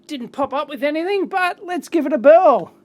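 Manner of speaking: ordinary talk, nothing marked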